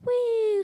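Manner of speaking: high loud whee of a fall. Retold or imitated. Scotsman jumping off the building, high falling whee. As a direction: squeal as he drops.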